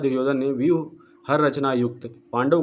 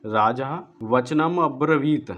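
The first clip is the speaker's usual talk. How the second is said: slow, measured chanting of the verse; slow Sanskrit verse recital; Sanskrit shloka recitation; slower than usual. Shown as a demonstration. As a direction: recite the shloka deliberately, syllable by syllable. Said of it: "ये पूरा श्लोक है".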